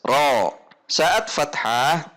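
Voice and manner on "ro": "Ro" open, with only the tongue root raised. Shown as a demonstration. Teacher models an open, thick "Ro". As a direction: lift the back of the tongue on "Ro", lips unrounded. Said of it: "pangkal lidah saja yang naik".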